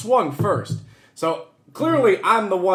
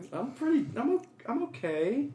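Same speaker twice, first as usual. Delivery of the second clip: small, high, soft mock voice; timidly protest, hesitant and higher; meek self-defense; 5 semitones higher, 11 dB quieter than usual. Teasing friend who objects to being called weak, meek mock protest. Mimicking someone insecure about strength.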